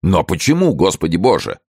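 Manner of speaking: a touch of shocked disbelief; astonished cry. A narrator's reading. Friends demanding an explanation from their host, astonished cry. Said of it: "вскричали его друзья".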